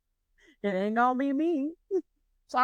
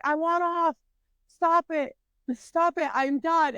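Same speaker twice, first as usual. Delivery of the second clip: raised, high-pitched mock yelling; retold screaming; 6 semitones higher, slower than usual. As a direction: demand to be let off, in a contained yell. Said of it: "I'm screaming at the people".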